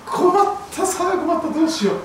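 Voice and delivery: high-pitched, flustered parent; acted parental panic. Acted parents unable to hush baby. (困り果てた親を演じる高い声)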